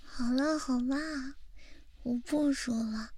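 normal talking voice

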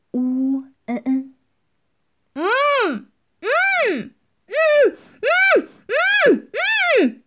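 muffled moans through a gag; wordless high hums, mouth blocked; gagged wailing. Acted. Gagged underling trying to scream, muffled moans. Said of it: "声音通过口塞的过滤".

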